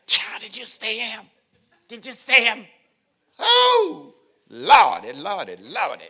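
high, swooning gossip among women; gossiping ladies. Acted. Preacher voicing ladies swooning over a man. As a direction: gush over the handsome man to your girlfriends. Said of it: "that's how they talk when they're with themselves".

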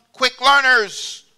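high, loud, drawn-out "quick learners"; emphatic. Retold or imitated. Preacher voicing Nebuchadnezzar's demands, slow emphatic high stress. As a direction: stretch and lift each word for emphasis. King listing wanted qualities.

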